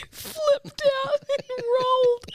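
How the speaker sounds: high, slow, breaking into laughter; laughing through words. Real, not acted. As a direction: barely get it out for laughing. Cracking up at own tumble.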